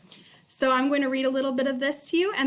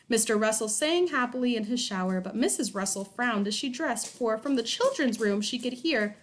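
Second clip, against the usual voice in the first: ordinary delivery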